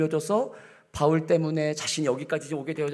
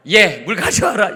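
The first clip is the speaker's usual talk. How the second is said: loud, higher barked demand; bossy order; 7 semitones higher, 11 dB louder than usual. Retold or imitated. Father-in-law ordering the daughter-in-law to fetch water, loud bossy. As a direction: order it like a gruff master. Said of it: "이게 아니고".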